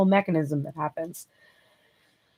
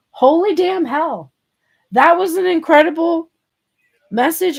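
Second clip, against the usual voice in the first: amazed outburst; 9 semitones higher, 6 dB louder than usual. Real, not acted. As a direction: let it burst out in awe. Amazed reaction to card message.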